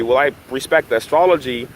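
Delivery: normal talking voice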